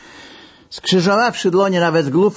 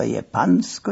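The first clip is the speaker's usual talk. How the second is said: usual speaking voice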